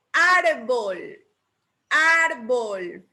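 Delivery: Árbol with a loud, stretched AR; Árbol said carefully, AR emphasized, bol dropping away; stress on Árbol's first syllable. Shown as a demonstration. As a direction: punch and hold the AR in Árbol. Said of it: "Lo lleva en la ar".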